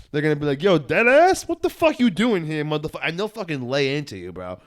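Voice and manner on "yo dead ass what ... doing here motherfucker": high-pitched, mock-indignant New Yorker; confrontational New Yorker. Man imitates New Yorkers confronting an asshole, high indignant challenge. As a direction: imitate a New Yorker laying right into someone. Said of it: "someone who's being an asshole in New York City".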